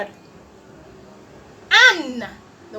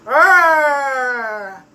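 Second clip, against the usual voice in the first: louder growling R like a monster; playful monster growl on R; scary R sound; 7 dB louder than usual. Acted. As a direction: growl the R to frighten the kids. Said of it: "It makes the scary sound".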